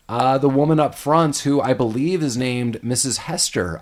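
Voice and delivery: normal talking voice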